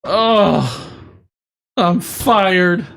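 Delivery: high, wailing lament; mock despair. Employee just fired by the boss, mock despair. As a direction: wail it like a hammed-up sob.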